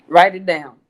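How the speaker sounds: mock-stern mama, loud on "write it down"; mama's firm command. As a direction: hit "write it down" like mama laying down the law. Mama's advice quoted in story.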